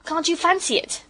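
high, loud indignant porter; affronted working-man exclamation. A narrator's reading. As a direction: scoff in acted outrage. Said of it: "said Perks indignantly".

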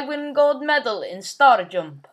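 thick put-on Russian accent, broken English; heavy Russian accent menace. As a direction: play a comic Russian gamer with a heavy accent. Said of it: "Shut up, talking like that".

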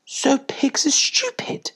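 high, slow puppy question; puppies. A narrator's reading. Puppies asking their mother, in a high slow voice. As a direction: pipe it up slowly as a curious pup.

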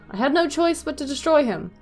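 ordinary delivery

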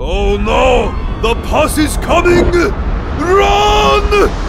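rising panicked yell, going high; panicked shout. Acted. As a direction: shout a warning then flee. Pimple bursts, characters flee.

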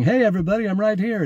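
high, eager call to the room; a spirit waving to be noticed, pitched high; calling out from beyond. Retold or imitated. A dead man hails the people around his body, high and eager.

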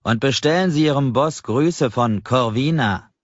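ominous parting words. Acted. Customer's parting ominous remark.

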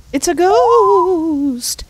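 very slow, high ghost warning; alarmed cry. Acted. Character announces the ghost, slow, high and alarmed. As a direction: stretch out the alarm.

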